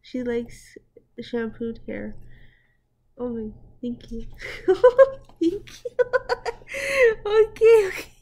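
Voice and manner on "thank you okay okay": laughing hard. Real, not acted. Laughing hard at funny clip.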